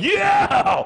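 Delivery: very high, loud whoop; joyful scream of the ride; thrilled yell. Retold or imitated. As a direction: cheer like the coaster just dropped. Cheering on roller coaster drop.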